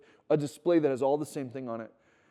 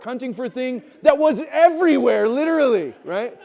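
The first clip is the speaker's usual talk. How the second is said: much higher and louder, bursting with laughter; laughing exasperated rant; 10 semitones higher, 12 dB louder than usual. Real, not acted. Pastor telling congregation about his son's mix-up, laughing loudly. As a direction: rant in amused disbelief at the audacity.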